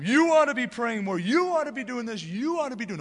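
high, loud hammering preacher imitation; mock pulpit scolding. Preacher mocking guilt-driven sermons, high loud mock scolding. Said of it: "I hear from pulpits preached all the time".

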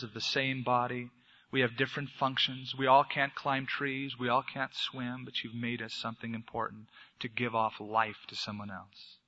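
soft, hushed closing prayer. Acted. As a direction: lead the church quietly into prayer.

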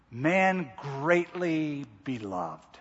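slow, hushed, awestruck; awed whisper of the title. Retold or imitated. Preacher voicing the angel's title to Daniel, slow and hushed. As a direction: savor each word as if overwhelmed. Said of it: "would make him go".

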